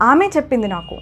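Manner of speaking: ఆమె said first and weighted, the rest falls away; stress on ఆమె. Shown as a demonstration. Teacher showing students the stress, leaning on ఆమె. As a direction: punch ఆమె, let చెప్పింది నాకు drop. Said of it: "నేను ఆమె పైన stress చేస్తున్నాను".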